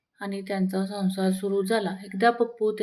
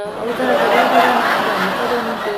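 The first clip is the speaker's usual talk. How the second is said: laughing loud wife; 2 semitones higher, 9 dB louder than usual. Acted. Wife can't stay silent.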